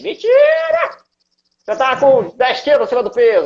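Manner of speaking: shrill high shouted inner critic; nagging little voice. Retold or imitated. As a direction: scream it like a mocking inner voice. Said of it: "Aí vem aquela vozinha assim".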